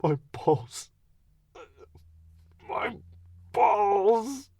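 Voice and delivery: high, squealing groans of pain; squeaky groaning. Retold or imitated. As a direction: moan it in a mouse squeak. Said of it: "I kept groaning".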